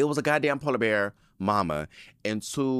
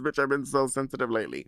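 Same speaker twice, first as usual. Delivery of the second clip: ordinary delivery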